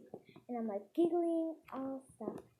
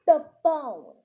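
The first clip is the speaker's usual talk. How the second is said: loud, higher insistent order; stern teacher command; 4 semitones higher, 14 dB louder than usual. Acted. Teacher ordering mean girl to phone, stern acted command. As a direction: cut off the protest loudly.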